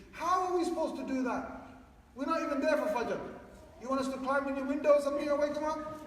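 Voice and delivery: high-pitched protesting parent; indignant parent. Acted. Mimics parents objecting.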